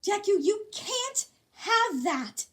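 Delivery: high slow loud outcry of alarm; crying out. A narrator's reading. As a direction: plead it out like panicking. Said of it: "Araka cried".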